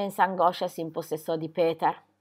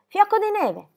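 high-pitched, fond cry of delight; delighted exclamation; 11 semitones higher, 3 dB louder than usual. A narrator's reading. Girl to her little goat, delighted exclamation. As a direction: exclaim it affectionately, pitched well up. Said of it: "esclamò".

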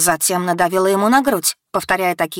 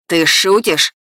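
incredulous yell, moderately raised; furious man's outburst, slightly held back; angry shout; 4 dB louder, slower than usual. A narrator's reading. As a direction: shout it in disbelief. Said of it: "снова закричал он".

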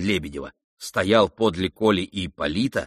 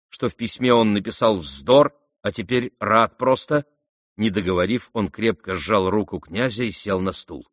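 ordinary delivery, nothing marked

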